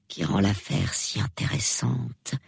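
soft, musing murmur. A narrator's reading. Old lady musing on the mystery, soft murmur. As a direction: murmur it softly, as if pondering. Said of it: "murmura-t-elle".

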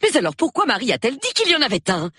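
fast, high-pitched wife; insistent challenge. A narrator's reading. Wife returns to the attack on husband, insistent. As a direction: press the question quickly and high. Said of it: "Elle revint à la charge".

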